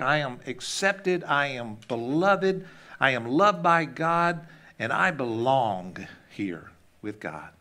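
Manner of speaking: slow, deliberate affirmations; affirming inner voice. Acted. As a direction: declare each truth slowly, weighing every phrase. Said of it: "this one voice saying these beautiful words".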